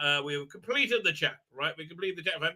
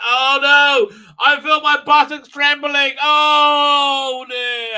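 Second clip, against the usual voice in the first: high, loud melodramatic wail; hammed-up horror, shouting it out; over-the-top fake panic; mock dread; 8 semitones higher, 12 dB louder, much slower than usual. Mocks dread of shitness coming.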